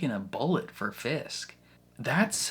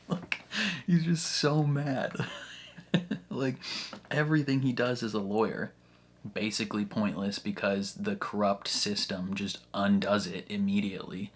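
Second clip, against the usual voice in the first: usual speaking voice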